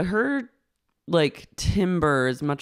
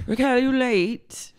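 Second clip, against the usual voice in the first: high, put-on questioning; lightly voiced boss; 7 semitones higher than usual. Boss questioning late waitress, lightly put-on voice. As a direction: ask it in a higher put-on voice. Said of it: "She goes".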